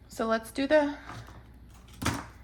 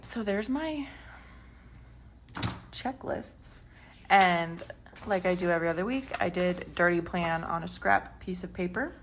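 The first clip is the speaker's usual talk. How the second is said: normal talking voice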